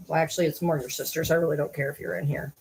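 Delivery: usual speaking voice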